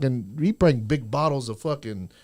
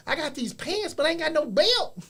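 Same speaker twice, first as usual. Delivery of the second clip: lightly voiced yard guy; 10 semitones higher, 6 dB quieter than usual. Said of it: "He's like".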